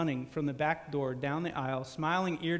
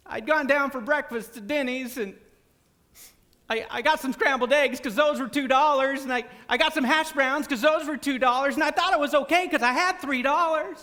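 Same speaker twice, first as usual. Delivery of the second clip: testimony; 8 semitones higher, 4 dB louder than usual. Retold or imitated. Friend's staged testimony.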